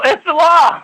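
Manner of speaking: imitating people, high and loud: It's the law; mocking insistence. Mimicking people who insist the statute is law, loud and mocking. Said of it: "And people go".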